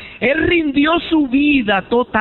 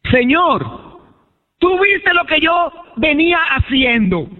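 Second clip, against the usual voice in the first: lightly acted plea to the Lord, pitched up; pleading convert; 9 semitones higher than usual. Saul surrendering his life to the Lord, pleading. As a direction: plead earnestly in a raised preacher's voice. Acts out Saul's surrender to God.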